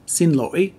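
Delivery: xin lỗi with a broken, rising lỗi; Northern pronunciation. Shown as a demonstration. Teacher showing excuse me in the north, Northern tone. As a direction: lift lỗi sharply at the end. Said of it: "in the north, it's pronounced".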